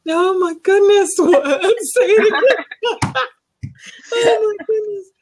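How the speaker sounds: high, laughing exclamations. Real, not acted. Host reacting to the English accent with high, delighted laughter.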